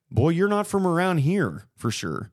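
high-pitched mock remark on a stranger; imitated puzzled listener. Listener reacting to his accent, mock puzzled. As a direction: play the listener noticing an odd accent. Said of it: "when he talks, you're like".

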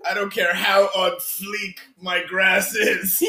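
slow, loud cranky complaint; grumpy old man. Acted. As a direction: grouse slowly like an old homeowner. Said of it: "Half Sack as an old grumpy".

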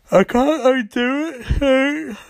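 high, slow, drawn-out; slow, high mock apology; mock solemn. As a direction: stretch it out, falsely grave. Barry voiced in a retelling.

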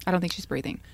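calm, flat retelling of the husband; husband's flat calm on the 911 call; flat, even keel. Retold or imitated. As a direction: say it like it means nothing. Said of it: "That's how he said it".

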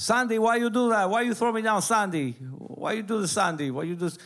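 high, pleading imitation of dad; hurt dad. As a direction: ask her why like a wounded husband. Father hurt, asking wife why.

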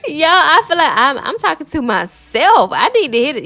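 holding back tears while talking to herself; emotional, voice breaking. Real, not acted. Tearful vlogger admitting she needs this message too, voice breaking. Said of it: "I'm getting emotional".

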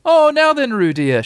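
high, loud sing-out mother voice; brisk motherly call. A narrator's reading. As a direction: sing it out high to a small child. Kanga brightly addresses pretend Roo.

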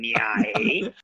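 normal talking voice

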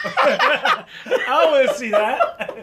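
laughing through the words. Real, not acted. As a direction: crack up while refusing. Laughing at gross story.

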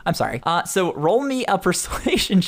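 ordinary delivery

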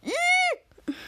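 very high, short squeal; thin high-pitched eee. Retold or imitated. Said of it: "he squeals when he takes the picture".

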